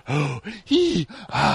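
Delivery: imitated gasping for air; struggling to breathe. Host mimics Robert in the diary room, struggling to breathe. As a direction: goof on someone fighting for breath. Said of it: "goofing about how he couldn't breathe".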